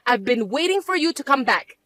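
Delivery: ordinary talk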